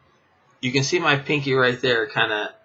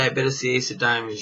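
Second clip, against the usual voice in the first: fast, tossed-off bet on the card; quick bet; 4 dB quieter, much faster than usual. Shown as a demonstration. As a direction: rattle off the bet in passing. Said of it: "and when you say".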